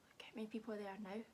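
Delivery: put-on fast hushed whisper to the kids; quick conspiratorial whisper. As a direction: whisper so the bear won't wake. Whispered aside, sleeping bear.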